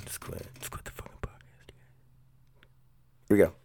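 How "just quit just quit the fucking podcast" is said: whispered despair. Real, not acted. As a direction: whisper it, voice dropped right away. Muttering after a laughing fit.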